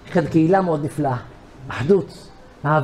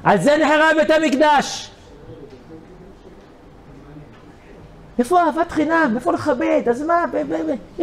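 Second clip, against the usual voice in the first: high, pleading rebuke; high, fervent sermon appeal; impassioned preaching; 9 semitones higher than usual. Acted. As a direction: cry out against the lack of love. Preacher's outraged lament.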